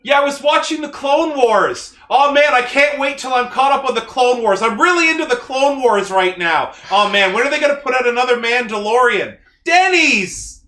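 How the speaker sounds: high, loud, excitable fanboy send-up; hammed-up friend impression, peaking on a shouted last word; impression of co-host. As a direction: gush loudly as your over-eager podcast pal. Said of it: "I could do an impression of all of you".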